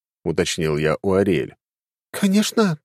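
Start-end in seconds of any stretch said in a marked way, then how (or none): 2.1-2.8 high, as if the question is odd; of course, obviously; surprised young woman. Acted. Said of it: "та даже удивилась вопросу".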